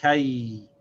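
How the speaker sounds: "kai" with ka gliding into y; "kai" closed on the y; demo syllable kai. Shown as a demonstration. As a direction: join ka and ya as "kai". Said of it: "You see how it's pronounced?".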